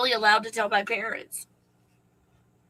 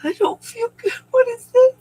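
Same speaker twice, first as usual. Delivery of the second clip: high, breaking sobbing voice; crying hard, overwhelmed and scared; real crying; 13 semitones higher, 5 dB louder than usual. Real, not acted. Crying hard, telling viewers about a forgotten memory. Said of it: "I don't know what's going on".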